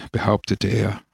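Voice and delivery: ordinary delivery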